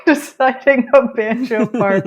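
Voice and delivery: words spilling out through a laugh. Real, not acted. Laughing at how she improvised her banjo parts. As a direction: laugh while you say it.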